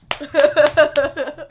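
loud, high fake laugh; imitated forced guffaw, pushed out loudly; trying to laugh. Monk slapping the table, trying a real laugh. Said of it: "he's trying to real laugh".

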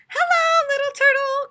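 high, loud sing-song call; calling kids. A narrator's reading. Kids on the bus greeting turtle, high loud call. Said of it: "called the kids".

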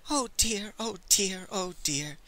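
a high start that sinks into a soft, frightened whimper. A narrator's reading. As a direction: whimper it like a terrified little clerk. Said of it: "the poor fellow whimpered".

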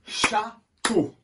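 "Château" split into two spaced beats; syllable by syllable. Shown as a demonstration. Teacher asks pupils how many beats, syllable by syllable. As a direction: say Châ, pause, teau for "Château". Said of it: "Ça fait combien?".

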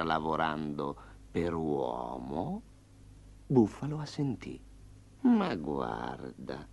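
low, slow, sly tiger talking to Buffalo; a lazy predator drawing out each word, low. Acted. Tiger sizing up Buffalo on the road, low and sly. Tigre voiced in fable.

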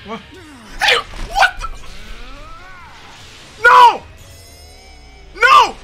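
ordinary delivery

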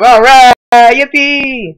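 high, loud happy cheer; excited cheer. Real, not acted. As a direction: celebrate out loud. Cheering over nine thousand views.

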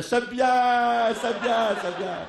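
high, lightly voiced mock praise; light imitation of people jeering; mocking crowd. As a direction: tease them like the onlookers. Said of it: "tous les gens en face nous faisaient".

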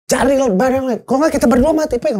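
high-pitched urgent order; clear instruction. Retold or imitated. Survivor warning a panicking man, high insistent instruction. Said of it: "instruksi jelas tuh".

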